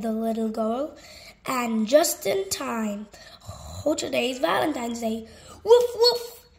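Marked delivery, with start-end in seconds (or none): 5.6-6.5 high, loud yappy puppy barks. Acted. Reader barking as Biscuit the puppy, high and loud. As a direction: yip like a little puppy, much higher.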